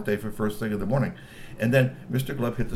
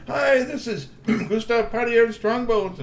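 high slow camera greeting; 12 semitones higher, 4 dB louder than usual. A narrator's reading. Playback greeting.

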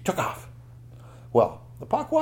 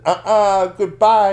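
loud, high sing-out farewell; storybook heroine boldly refusing; cheeky refusal; 9 semitones higher, 12 dB louder, slower than usual. Acted. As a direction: taunt the pursuers with a goodbye. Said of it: "And Cupon said".

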